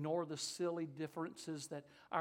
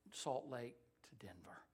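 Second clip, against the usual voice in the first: usual speaking voice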